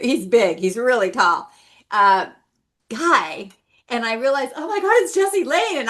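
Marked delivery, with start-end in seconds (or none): 4.5-5.8 high, thrilled recognition; starstruck gasp. Retold or imitated. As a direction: gasp like a fan spotting a star. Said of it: "in fangirling completely".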